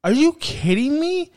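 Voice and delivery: can't believe it, voice jumps high; incredulous high cry; exasperated disbelief. Real, not acted. Ranting at a flopping player.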